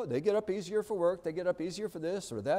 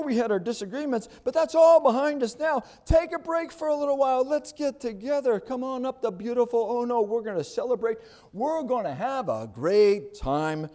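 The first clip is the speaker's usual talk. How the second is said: high, bright-sounding sweet-talk, raised well up; mock friendly invitation; 9 semitones higher, 4 dB louder than usual. Preacher voicing the enemies' invitation, mock friendly and high. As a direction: oversell the invite like a smooth flatterer.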